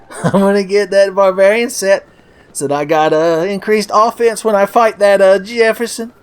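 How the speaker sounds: high blustery sitcom dad; Archie Bunker impression. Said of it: "my beloved Archie and Edith Bunker impressions".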